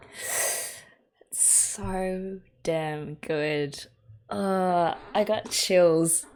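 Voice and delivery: ordinary delivery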